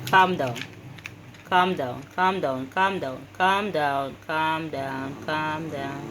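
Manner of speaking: low, repetitive calming murmur to an animal; soothing. Real, not acted.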